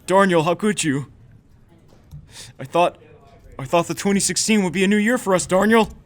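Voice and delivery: high, loud, hammed-up dismay; mock betrayed outcry. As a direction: cry out as the betrayed friend. Acted betrayal by friend.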